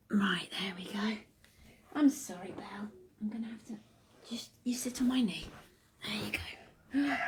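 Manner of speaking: hushed whisper settling the little one; quiet unvoiced whisper. Real, not acted. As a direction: breathe it softly. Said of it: "whispering while settling".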